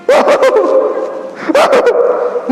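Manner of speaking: high wailing sobs, hammed-up; mock crying. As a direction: fake a sudden high-pitched crying fit in prayer. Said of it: "udah nangis dia". (pura-pura menangis tersedu-sedu)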